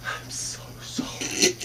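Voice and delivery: slow sheepish whisper; whispering, slow and embarrassed; whispered apology. Retold or imitated. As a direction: lean in and whisper sorry. Mark whispering apology to lady.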